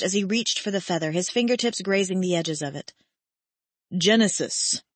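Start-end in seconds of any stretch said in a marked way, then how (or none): 4.0-4.8 very low, slow and grave; deep slow command. Acted. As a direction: order her gently but firmly.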